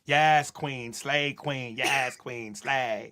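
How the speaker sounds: low mock cheer, lightly voiced; mock the empty cheerleading; mocking hype cheer. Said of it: "hypocrisy and the contradiction".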